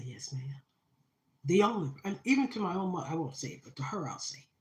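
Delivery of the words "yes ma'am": meek yes ma'am. Retold or imitated.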